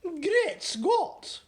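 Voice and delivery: high, loud startled exclamation; cry of surprise. Acted. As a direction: burst out in royal shock. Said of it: "cried the famous voice".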